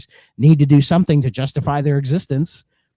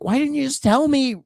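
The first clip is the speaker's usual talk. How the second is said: high-pitched retold exasperation; protesting, pitched way up; exasperated complaint; 11 semitones higher, faster than usual. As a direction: plead in indignant frustration. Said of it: "But it's like".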